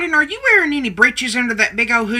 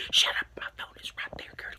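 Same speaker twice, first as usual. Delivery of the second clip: urgent voiceless whisper; 12 dB quieter, faster than usual. Acted. Nanny Ma hushes Carolyn about the listening phone, urgent whisper. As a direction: hush her before the phone hears.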